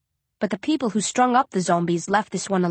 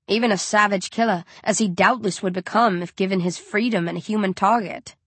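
plain reading voice

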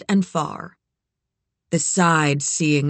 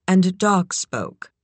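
ordinary delivery

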